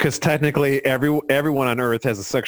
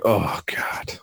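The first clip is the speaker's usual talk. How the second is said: breathy hushed dismay, barely voiced; whispered oh God; 5 semitones lower, 5 dB quieter than usual. Host voices Wheeler's hushed despair at the ballet taunt. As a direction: whisper it like he's crushed. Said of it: "And then he's like".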